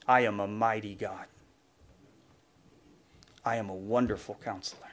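low, measured declaration; solemn God quote. Acted. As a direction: drop the pitch and let each title settle. Closing declaration in God's voice.

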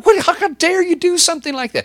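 very high, loud indignant outburst; a churchgoer outraged at the police beating a friend; outraged cry. Acted. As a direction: cry out in disbelief and rage. Outraged at the violence.

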